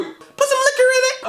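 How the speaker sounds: squeaky chipmunk singing voice, way up high; chipmunk squeak. Acted. Reviewer quoting the song lyric in a high chipmunk voice. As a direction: sing it like a sped-up chipmunk.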